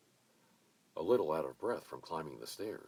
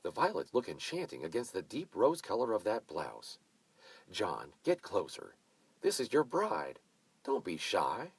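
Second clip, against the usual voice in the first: bossy hostess; 4 semitones higher, 6 dB louder than usual. A narrator's reading. Mavis directing the photo.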